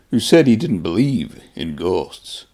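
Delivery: ordinary delivery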